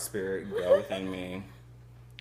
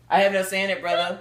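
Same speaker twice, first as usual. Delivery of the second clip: high, louder character voice; voiced character reply; 8 semitones higher, 6 dB louder, faster than usual. Character loudly replying to his brother. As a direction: answer the brother in a raised voice.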